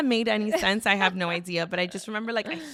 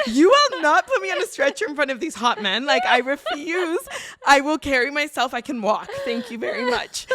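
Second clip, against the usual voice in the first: higher, louder, haughty refusal; indignant refusal; 5 semitones higher, 7 dB louder than usual. Retold or imitated. Refusing the stretcher in front of hot medics, haughty and loud. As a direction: refuse them grandly, chin up.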